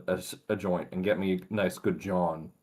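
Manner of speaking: put-on Philly accent. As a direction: lean a little into a Philly accent. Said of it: "say the name in full-on accent".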